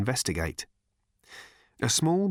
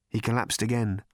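plain reading voice

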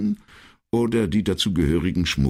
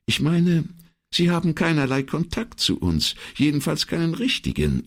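high-pitched secretary clarifying; explaining secretary; 12 semitones higher than usual. Acted. Secretary explains to the puzzled commissario, high-pitched. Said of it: "erklärte die Signorina".

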